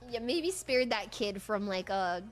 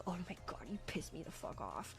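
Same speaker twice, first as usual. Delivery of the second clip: mock annoyed mom, much softer; 6 semitones lower, 14 dB quieter than usual. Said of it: "and she's like".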